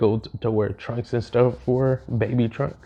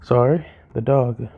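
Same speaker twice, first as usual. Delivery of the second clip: normal talking voice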